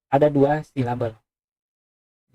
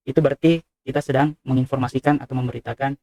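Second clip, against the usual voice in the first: normal talking voice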